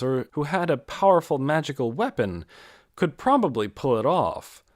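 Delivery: usual speaking voice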